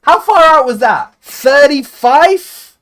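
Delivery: loud, high disbelief; amazed. Real, not acted. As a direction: gasp it out in disbelief. Amazed by long free kick.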